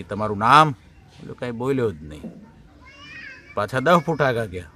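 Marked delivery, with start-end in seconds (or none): 0.0-0.7 loud shouted question; doctor shouts. Retold or imitated. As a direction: call out the name question loudly. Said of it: "રાડી નાખી". (જોરથી બૂમ પાડીને પૂછવું)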